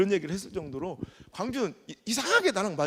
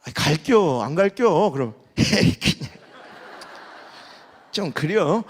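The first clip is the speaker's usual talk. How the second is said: a hedging, drawn-out non-answer with a laugh; evasive dodge; 7 dB louder than usual. Retold or imitated. Chungcheong churchgoer hedging over a revival meeting invitation, evasive and laughing. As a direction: dodge the invitation politely without saying no, a bit louder. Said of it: "이게 안 간다는 얘기예요".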